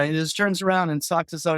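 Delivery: ordinary delivery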